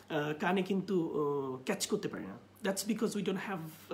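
usual speaking voice

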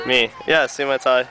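normal talking voice

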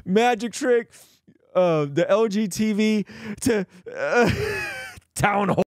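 squealing high with laughter, gasping the words out; helpless laughter. Real, not acted. Podcast host doubled over laughing at his guest's Jesus story, squeaky and breathless. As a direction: laugh so hard the words barely get through. Cracking up at Jelly's retelling.

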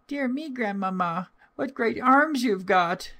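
put-on very high, childlike wonder; amazed little girl. A narrator's reading. Little girl puzzled by grandmother's looks, high childlike wonder. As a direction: marvel as a small child, voice pitched right up.

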